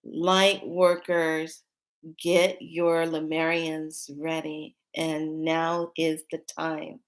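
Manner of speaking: slow, solemn spirit voice giving a call; slow message from spirit. Retold or imitated. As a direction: intone it like a summons from above. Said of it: "I heard".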